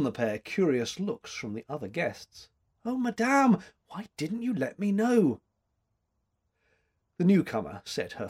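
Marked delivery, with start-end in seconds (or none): 2.8-5.4 excited. A narrator's reading. Joyful reunion with a mentor.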